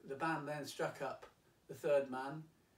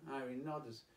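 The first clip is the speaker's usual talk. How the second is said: normal talking voice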